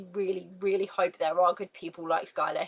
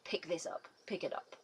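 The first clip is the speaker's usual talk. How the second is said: soft aside; 4 semitones lower, 13 dB quieter than usual. Real, not acted. Urging viewers to pick it up.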